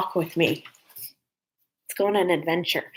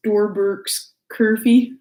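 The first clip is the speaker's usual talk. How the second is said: Swedish chef; 3 semitones higher, 6 dB louder, much slower than usual. Retold or imitated. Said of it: "the Swedish chef from the Muppets".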